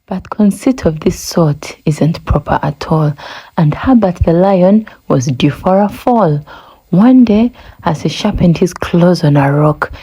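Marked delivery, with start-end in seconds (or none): none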